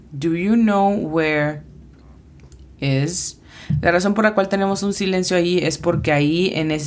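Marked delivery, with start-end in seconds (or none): 0.1-3.4 "Do you know where" said slowly and evenly; slow careful model. Shown as a demonstration. Teacher repeating the phrase slowly for students. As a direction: give it again slowly after spelling. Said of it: "la pronunciación fonética es".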